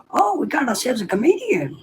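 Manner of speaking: high, sarcastic cartoon officer; Foghorn Leghorn. Petty officer to a recruit correcting him, high mocking cartoon voice. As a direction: mimic a blustering officer mocking a smart aleck. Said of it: "they do sound like Foghorn Leghorn".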